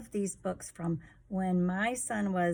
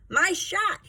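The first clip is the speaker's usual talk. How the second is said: very high, loud whining howl; howled claim; 11 semitones higher, 8 dB louder than usual. Acted. Sleater grabbing the ball, howling high and loud. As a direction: wail it like it's yours by right. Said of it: "he howled".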